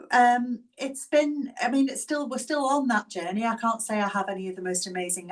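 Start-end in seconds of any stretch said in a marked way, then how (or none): 0.1-3.5 choked up, pitch climbing as emotion catches; real emotion welling up mid-sentence, words catching; voice breaking. Real, not acted. Caregiver telling webinar audience about partner's heart failure, voice breaking.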